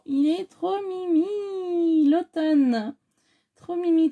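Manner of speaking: high, slow, cooing over something cute; gushing. Real, not acted. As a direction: melt over the little squirrel. (s'extasier d'une voix aiguë et lente devant l'écureuil)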